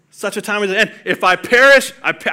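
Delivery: hammed-up heroic line; dramatic movie line. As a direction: deliver it like a film's big moment. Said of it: "I got goosebumps".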